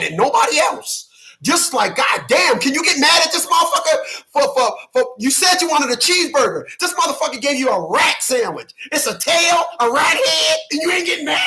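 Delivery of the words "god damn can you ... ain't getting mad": high-pitched incredulous goading; comically exasperated, voice riding high; exasperated disbelief. Acted. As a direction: plead in high, baffled outrage. Mock pleading with a passive woman.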